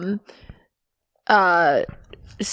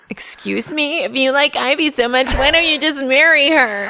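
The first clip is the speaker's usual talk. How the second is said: mock indignant; 4 semitones higher than usual. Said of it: "and I'm like".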